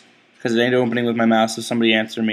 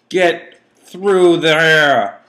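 strained; 4 semitones higher, 5 dB louder, much slower than usual. Real, not acted. Straining at a hard jump.

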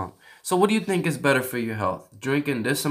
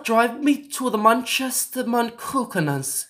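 high-pitched hammed-up British accent; bad British accent; 7 semitones higher than usual. Giving voice commands to car, high mock British accent. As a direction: put on a terrible London accent, pitched high. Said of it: "worst accent I've ever heard".

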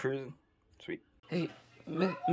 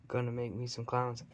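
normal talking voice

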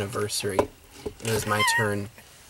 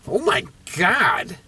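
shocked; 6 semitones higher, 4 dB louder, slower than usual. Real, not acted. Reacts to a guess of seven.

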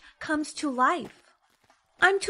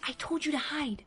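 ordinary delivery

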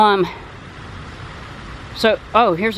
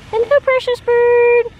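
slow, squeaky high, louder coo; pet talk; 12 semitones higher, 4 dB louder, slower than usual. Real, not acted. Cooing to the pet parrot, slow squeaky high pet-talk. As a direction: drag it out sweetly to the bird.

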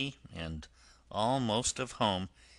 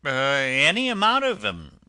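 higher, loud mock sob; sobbing washerwoman; 5 semitones higher, 9 dB louder than usual. Toad in disguise answering the driver, hammed-up sobbing. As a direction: blubber it out in a high wail. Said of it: "sobbed Toad".

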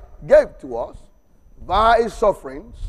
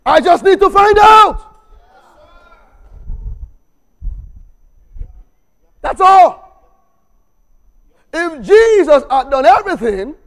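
higher, louder, driving sermon; full-force preaching; 8 semitones higher, 8 dB louder than usual. Acted. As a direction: preach it out with fire.

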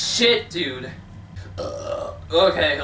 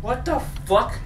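ordinary delivery

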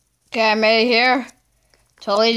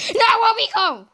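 high calling out; 10 semitones higher than usual. Acted. Calling to pet, repeated.

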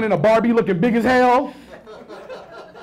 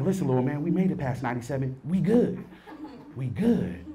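quiet reassurance; 4 semitones lower, 7 dB quieter than usual. Retold or imitated. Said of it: "And I'm like".

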